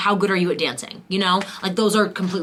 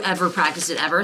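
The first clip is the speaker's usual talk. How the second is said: normal talking voice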